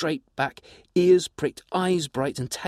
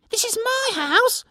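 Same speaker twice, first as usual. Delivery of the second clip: much higher, louder indignant pixie cry, a bit slow; shrill little creature defending its home; 5 dB louder than usual. Acted. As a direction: pixie crying out at intruders in its house. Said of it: "it cried".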